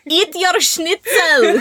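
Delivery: high, slow mock German accent, laughing; bad German accent. Answering Abby's schnitzel joke, slow bad German accent. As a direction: drag it out in a hammed-up German accent. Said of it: "the bad German accent".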